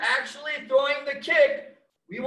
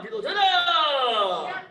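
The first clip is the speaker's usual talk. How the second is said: long drawn-out drill call; sharp martial arts commands; slower than usual. Real, not acted. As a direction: call the count to the whole class, drawn out. Instructor calls out kick count.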